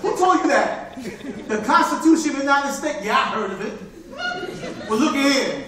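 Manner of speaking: loud, high, swaggering stick-up man; a mugger who turns out to be a brother; street robber. Acted. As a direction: menace him, then laugh it off. Stick-up man robs a newcomer.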